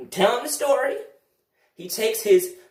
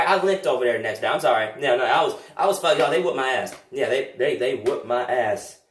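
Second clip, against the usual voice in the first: low, rueful admission; low rueful confession; sheepish; 8 semitones lower than usual. Acted. Sheepish correction.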